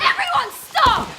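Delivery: high, loud order to halt; commanding shout. Acted. Ordering everyone to stop fighting, commanding shout. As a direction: shout over a brawl to stop it.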